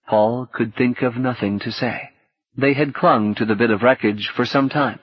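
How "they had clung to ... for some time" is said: ordinary delivery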